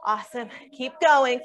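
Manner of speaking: ordinary delivery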